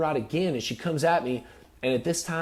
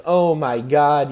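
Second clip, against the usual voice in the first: loud, slow shocked cry; a burst of shock as she attacks; retold alarm; alarmed exclamation; 3 semitones higher, 8 dB louder, slower than usual. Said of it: "I'm like".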